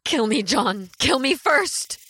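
begging in terror; desperate plea. Acted. Mary begs to die first.

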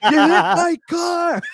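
high, slow indignant yell; angry driver. Retold or imitated. Co-host voicing the furious driver, slow high yelling. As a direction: rant at the guy who hit the car. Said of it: "he's like".

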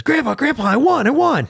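put-on high, delighted shout of a winning kid; excited kid. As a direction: squeal it like a kid who just won. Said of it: "he goes".

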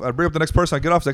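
ordinary talk, nothing marked

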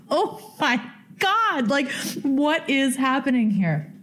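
high, slow, breathless amazement; like you can't believe what just happened; stunned disbelief. Retold or imitated. Telling listeners her thoughts on the rock, awed disbelief. Thinking as stranger leaves.

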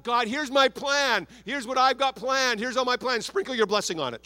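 mock self-important planner. Pastor mocking a believer who tells God his plans, hammed-up and smug. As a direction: lay out your plans as if God just signs off. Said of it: "It's not, it's not".

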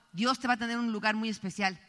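ordinary talk, nothing marked

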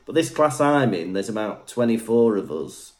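ordinary delivery

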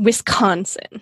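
"Wisconsin" slowly, with a stretched open "ah" in "con"; imitated pronunciation. Co-host echoing how Ellen says it, slow imitation of "Wisconsin". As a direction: draw out the middle syllable of "Wisconsin". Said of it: "I love how you say that".

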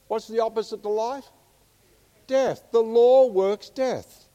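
loud, high preaching, building; rising fire-and-brimstone preaching; preaching at full force. Acted. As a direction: preach it out to the congregation.

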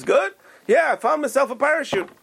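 high-pitched comic British gentleman; British accent. Retold or imitated. Two English gentlemen talking in the bar, hammed-up British accent. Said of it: "He puts on this British accent".

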